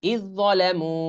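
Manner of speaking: ইয যালামু with dal silent, z doubled; tajweed idgham; merged dal. Shown as a demonstration. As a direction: say ইয যালামু without the d. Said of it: "দর উচ্চারণ হবে".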